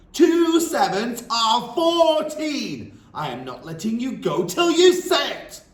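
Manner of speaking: very slow, high, loud bellow. Acted. Headmistress bellowing the sum at the dangling boy, slow and loud. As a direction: hammer it home again.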